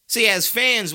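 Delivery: loud high call-out; loud, high opener; emphatic. Real, not acted. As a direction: shout it out to the fans. Sports rant, fired up.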